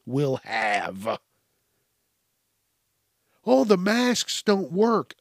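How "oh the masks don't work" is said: higher, slow, mock-dopey complaint; mocking objector. Mask doubter protests to him, mockingly imitated. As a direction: parrot a silly objection back at them.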